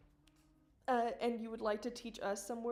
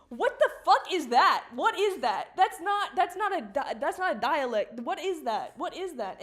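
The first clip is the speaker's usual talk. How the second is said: much higher, loud mock-outraged yelling; hammed-up disbelief for the joke; feigned outrage; 6 semitones higher, 10 dB louder than usual. Ranting to chat about the dialect, feigned outrage. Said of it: "My outrage is feigned".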